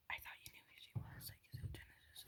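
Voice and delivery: hushed, unvoiced whisper; whispered question. Brother's friend secretly asking about the girl, whispered. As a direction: lean in and whisper it so she can't hear.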